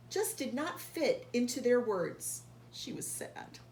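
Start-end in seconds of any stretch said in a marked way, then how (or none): 2.7-3.6 breathy, hushed and sorrowful; hushed sad whisper. Acted. Reading to a child, Q's sadness whispered softly.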